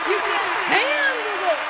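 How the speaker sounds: loud, slow, drawn-out taunt; playful shout. Pretend. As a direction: yell it back teasing. Shouting back in play.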